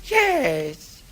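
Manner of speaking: high thrilled yes; eager scout voice. Retold or imitated. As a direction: yelp it out delighted. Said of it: "he said".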